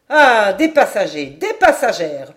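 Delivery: loud, effusive outburst; delighted exclamation. A narrator's reading. Stranger spotting passengers on deck, delighted exclamation. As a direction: cry out with relish at new company. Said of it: "L'étranger les aperçut et s'écria".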